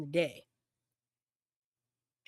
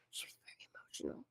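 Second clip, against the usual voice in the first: hushed; 17 dB quieter, much faster than usual. Retold or imitated. Quotes Jack, voice drops away.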